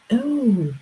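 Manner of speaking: high, astonished mock barber voice; amazed barbers. Barbers marvel at her long hair, high astonished gush. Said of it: "the barbers were like".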